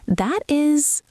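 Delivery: normal talking voice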